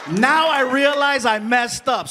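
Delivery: ordinary talk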